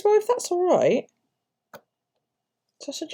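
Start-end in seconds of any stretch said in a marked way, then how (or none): 0.0-1.1 timid doctor. Retold or imitated. Nervous doctor asks politely.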